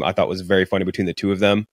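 ordinary talk

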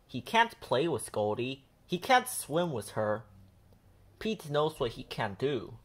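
ordinary delivery

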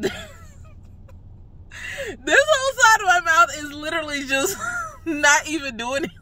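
high, laughing through the words. Real, not acted. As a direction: giggle helplessly while talking.

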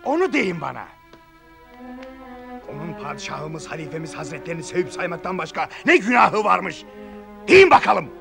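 angry challenge. Acted. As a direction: demand an answer in fury. Confronting the court angrily.

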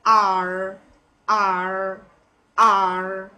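drawn-out American "R", r sounded at the end. Shown as a demonstration. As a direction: say "R" low with an American curled r. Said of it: "The correct one is R".

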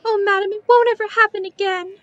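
crying, rushing her words in panic; tearful pleading. Acted. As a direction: beg through tears, fast and loud. Child begging not to be beaten.

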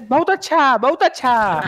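put-on high, foreign-accented Hindi praise; foreign pilot's cute Hindi. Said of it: "उसकी हिंदी थोड़ी funny plus cute".

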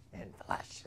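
whispered echo of the phrase. Shown as a demonstration. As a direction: breathe it out in a hushed whisper. Repeats phrase, second time whispered.

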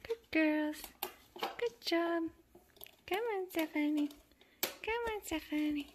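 high cooing praise; coaxing pet talk. Real, not acted. Praising kittens, calling Stephanie, high coaxing pet talk.

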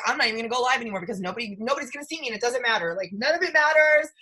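hurried mock panic that climbs high and loud; hammed-up meltdown rising into a loud, high cry; mock despair building to a wail. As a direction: rush it, then wail it out like your career is over. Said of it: "I was like".

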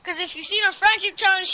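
ordinary talk, nothing marked

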